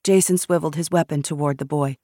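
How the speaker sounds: ordinary delivery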